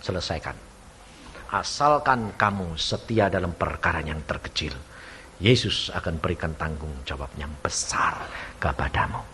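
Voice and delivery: low, soft, solemn exhortation; quiet, earnest preacher's conclusion; hushed sermon close. Real, not acted. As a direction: drop right down and assure the congregation gently.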